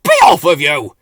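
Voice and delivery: very loud gruff dismissal; shooing them off. A narrator's reading. Slowcoach driving the flowerpot men out, loud gruff. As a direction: chase them out. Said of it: "he said".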